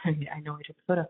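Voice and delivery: ordinary delivery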